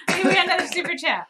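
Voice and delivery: loud, high, laughing announcement. Real, not acted. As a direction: burst out through the giggles. Laughing, announcing a super chat.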